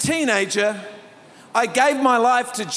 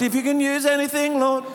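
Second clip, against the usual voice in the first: higher-keyed mock singing; key change up; 5 semitones higher than usual. Shown as a demonstration. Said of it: "then the key change comes".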